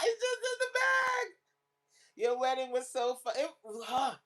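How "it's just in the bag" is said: laughing. Real, not acted. Laughing at her own dress.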